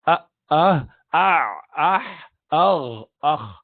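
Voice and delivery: the six throat sounds said in turn; throat letter review. Shown as a demonstration. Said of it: "remembered or memorized as".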